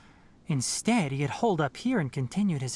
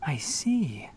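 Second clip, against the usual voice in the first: whispered. Real, not acted. Hearing the sad recording.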